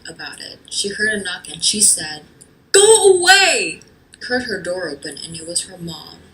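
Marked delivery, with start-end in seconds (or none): 2.7-3.8 yell. Acted. Yells at door.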